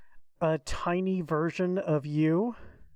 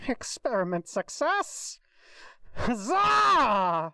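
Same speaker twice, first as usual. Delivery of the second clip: triumphant cheer; 6 semitones higher, slower than usual. Acted. Triumphant cheer over the creation.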